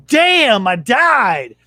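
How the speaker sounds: high, drawn-out DAMN, then falling; mock outburst. Kid cursing at a lost video game, mock outburst. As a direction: re-enact a kid's loud gaming curse.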